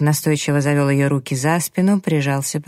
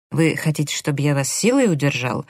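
usual speaking voice

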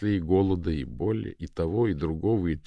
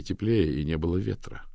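plain reading voice

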